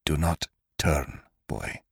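low, soft hoarse whisper. A narrator's reading. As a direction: rasp it out under the breath. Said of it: "came a hoarse whisper".

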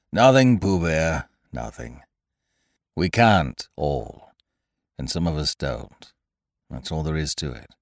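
doubt the whole morning; gloomy Eeyore. A narrator's reading. Eeyore doubts Pooh's good morning, low and mournful. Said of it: "Eeyore gloomily".

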